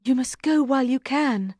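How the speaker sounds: high, pleading character voice; urgent queen. Acted. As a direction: urge him to flee. Queen urges knight to flee.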